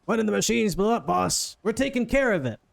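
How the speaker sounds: put-on voice of a harried underling; henchman reporting. Guy on the floor answers his boss, called-out reassurance. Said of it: "This guy over here is like".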